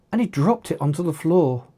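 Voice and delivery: ordinary delivery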